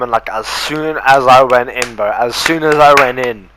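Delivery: normal talking voice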